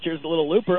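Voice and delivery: ordinary talk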